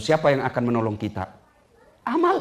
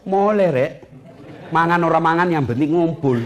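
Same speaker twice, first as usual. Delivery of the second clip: Javanese saying; 4 semitones higher, 3 dB louder than usual. Acted. Javanese phrase for migrants.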